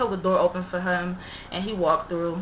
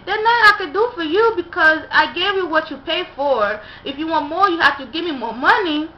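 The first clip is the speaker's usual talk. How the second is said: higher and pleading, with a cry in it; the worker telling the customer to pay more, higher and pleading; imitated worker refusing; 6 semitones higher, 4 dB louder than usual. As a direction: hold firm but sound upset, pitched up.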